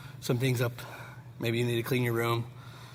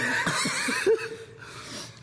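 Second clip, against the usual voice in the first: breathy laugh. Real, not acted. Laughing at spicy wing joke.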